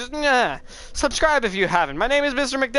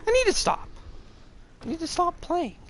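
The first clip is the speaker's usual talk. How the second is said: squeaky high complaint; high whine; 12 semitones higher than usual. Real, not acted. Gamer complaining to viewers, high whiny voice.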